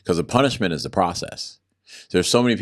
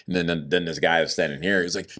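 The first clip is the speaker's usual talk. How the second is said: ordinary talk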